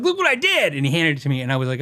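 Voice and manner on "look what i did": high-pitched imitation of an eager customer; excited mock "ta-da" voice; mock proud showing-off. Acting out proud customer showing guitar.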